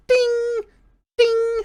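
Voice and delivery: high ringing ding imitations; hammer dings. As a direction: ring out like a hammer on an anvil.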